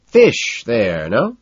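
deliberate and leading. A narrator's reading. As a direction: say it deliberately, as a pointed hint. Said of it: "then more deliberately".

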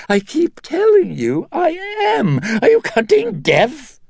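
high, wheedling British director; petulant high-pitched Englishman; Burke Dennings voice. Acted. Dennings character voice.

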